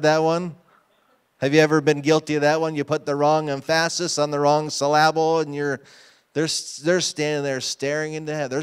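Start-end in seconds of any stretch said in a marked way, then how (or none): 3.2-5.4 "emphasis" said em-FASS-is, "syllable" said suh-LAB-ul; deliberately misplaced stress. Shown as a demonstration. Preacher asking who's guilty of it, "emphasis" and "syllable" stressed on their second syllables. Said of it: "old English phrase from school".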